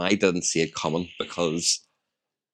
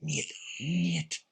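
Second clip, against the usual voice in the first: hushed mate; 6 semitones higher, 11 dB quieter, much slower than usual. Retold or imitated. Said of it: "he didn't do that 'cause he's Welsh".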